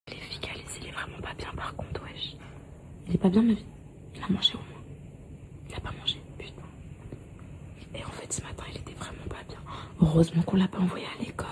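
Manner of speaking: hushed whisper to a friend. Real, not acted. Whispering about sick child off-mic.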